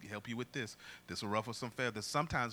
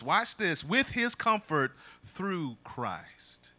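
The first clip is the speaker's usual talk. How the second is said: loud, raised scripture proclamation; preached scripture; 5 semitones higher, 11 dB louder than usual. Real, not acted. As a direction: declaim the verse loudly, leaning on key words. Reads scripture at full force.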